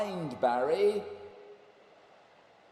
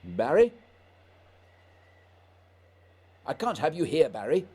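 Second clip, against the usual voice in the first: louder, urgent rebuke; alarmed, raised voice; 7 dB louder, faster than usual. Acted. Scott ordering Barry away from the ice, raised and urgent. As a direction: push him away with a raised voice.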